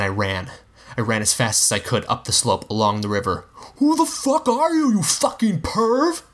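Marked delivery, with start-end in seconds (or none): 3.8-6.2 a far higher, furious yell, slowing at the end; angry shout. A narrator's reading. Rob yelling after the fleeing stranger. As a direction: bellow after the running stranger. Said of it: "Rob shouted after me".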